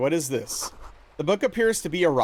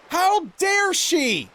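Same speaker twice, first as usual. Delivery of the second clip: mock outrage; 12 semitones higher, much slower than usual. Mock outrage at daughter's comment.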